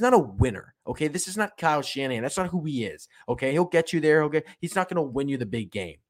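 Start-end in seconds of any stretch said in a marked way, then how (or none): none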